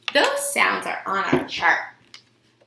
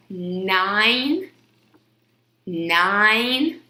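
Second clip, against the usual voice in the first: stretched slowly. Shown as a demonstration. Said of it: "the long vowel sound in the middle of nine".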